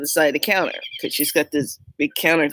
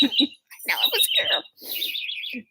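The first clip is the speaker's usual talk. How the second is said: laughing squeak; 9 semitones higher, 6 dB quieter than usual. Retold or imitated.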